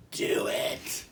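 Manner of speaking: eerie breathy demonic whisper. Retold or imitated. A hallucinated voice urging the driver on, demonic whisper. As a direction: hiss it like a demon tempting you. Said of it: "like, demonic voice".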